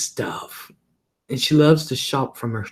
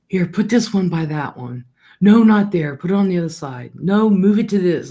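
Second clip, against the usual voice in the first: higher, louder bossy mother giving orders; bossy mom; 6 semitones higher, 3 dB louder than usual. Retold or imitated. Mom bossing her son around, higher and louder. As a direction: order him where to put things.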